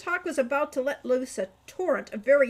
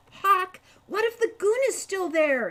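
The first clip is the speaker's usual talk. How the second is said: high, louder, breathless question; panting worry; 8 semitones higher, 5 dB louder than usual. A narrator's reading. Falcon, running after Hawk, panting his worry. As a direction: ask it while running out of breath. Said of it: "he panted".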